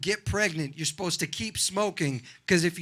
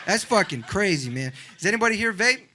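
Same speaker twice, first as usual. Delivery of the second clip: ordinary talk, nothing marked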